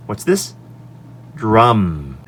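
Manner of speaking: usual speaking voice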